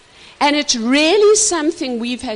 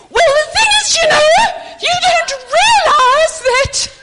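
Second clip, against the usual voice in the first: very high, loud mock argument; hammed-up high-pitched shout, pressing the point; excited tone demo; 8 dB louder than usual. Shown as a demonstration. Wife showing how she argues with husband, high excited shouting. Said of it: "because of my tone".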